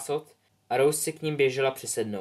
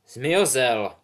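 hat's announcement; 6 dB louder than usual. Acted. Hat announces after long pause.

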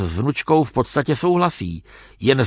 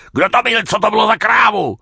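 loud, high-pitched enraged yell; furious shout; 9 semitones higher, 4 dB louder than usual. A narrator's reading. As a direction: shout it while lashing out all round. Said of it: "křičel Čech a mlátil kolem sebe". (vzteklý křik)

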